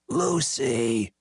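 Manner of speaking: croaked name, falling; hoarse drunk croak. A narrator's reading. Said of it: "he croaked".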